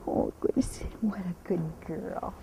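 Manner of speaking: slow hushed whisper to calm the horse; soothing whisper. Real, not acted. Trainer calming her quivering mare, whispered slowly.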